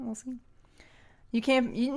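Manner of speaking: normal talking voice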